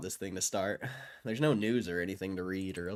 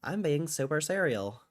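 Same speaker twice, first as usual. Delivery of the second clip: higher, louder femboy lilt; 6 semitones higher, 5 dB louder than usual. Shown as a demonstration. Streamer demonstrating a femboy voice for chat, high and affected. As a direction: put on an affected femboy voice, pitched up. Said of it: "Like that one?".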